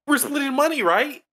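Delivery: high-pitched, needy mock question; imitated nagging check. Imitating the boss guy reminding everyone about the money, high nagging check. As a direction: pipe it up high, seeking reassurance.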